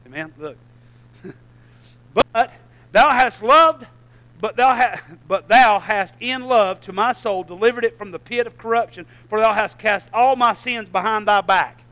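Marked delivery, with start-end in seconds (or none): 2.1-11.8 rising, declaiming the King James words; fervent scripture reading. Acted. Pastor reading scripture to the congregation, declaiming. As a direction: lift the old text up like a proclamation.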